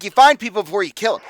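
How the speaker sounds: high, loud exasperation; heated argument. Acted. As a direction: hammer the point at them angrily. Hothead argues with the clients.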